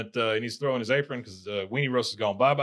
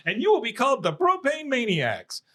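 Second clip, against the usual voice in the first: high mock-grand naming; Peggy; 12 semitones higher than usual. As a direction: bestow a title in a high, imitated voice. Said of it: "she says".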